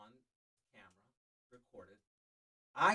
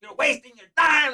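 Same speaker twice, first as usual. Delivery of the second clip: loud, slow, high mock dad; a scolding dad; imitating his dad; 9 semitones higher, 11 dB louder, slower than usual.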